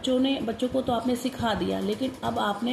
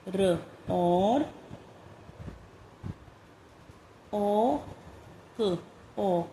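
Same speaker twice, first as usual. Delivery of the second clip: slow, spaced-out dictation of short sounds; careful classroom dictation, one word at a time; phonics dictation; much slower than usual. Shown as a demonstration. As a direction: read each word clearly for children to write. Teacher dictating phonics words.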